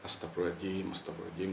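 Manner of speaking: soft, low muttered invocation; muttered prayer. Retold or imitated. Narrator quietly invokes God to calm himself, softly. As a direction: murmur it under your breath. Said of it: "saya nyebut nih ya".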